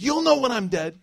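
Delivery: high, loud imitation of a scolding mother; mock an upset mom's outburst. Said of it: "whenever my mom would get upset at us".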